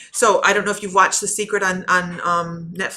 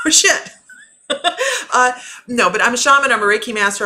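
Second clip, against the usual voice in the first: higher and louder, laughing through the words; 6 semitones higher, 6 dB louder than usual. Real, not acted. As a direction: laugh it off after being caught out. Caught out, laughing it off.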